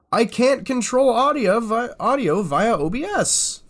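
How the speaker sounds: loud, high-pitched exasperation; frustrated outburst. Real, not acted. Streamer unable to control audio via OBS, frustrated outburst. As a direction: wail the complaint in real frustration. Reads setting, finds it failing.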